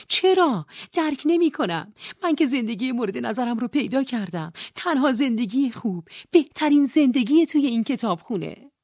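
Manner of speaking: high, loud bewildered protest; Nora distressed. Acted. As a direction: cry out in disbelief.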